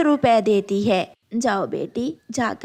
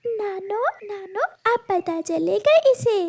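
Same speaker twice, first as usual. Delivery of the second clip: put-on high childlike pleading voice; a small girl asking to pass, higher; little girl voice; 9 semitones higher than usual. (ऊँची आवाज़ में छोटी बच्ची)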